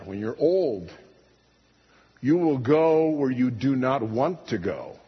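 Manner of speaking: ordinary delivery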